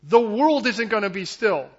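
forceful, high-pitched assurance; impassioned preaching. Acted. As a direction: preach God's promise with urgency.

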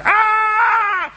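high strangled shout; badly sung high yelp; mock squawk. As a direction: squawk a terrible high note. Singing attempt, shouted cry.

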